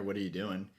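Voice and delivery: ordinary talk, nothing marked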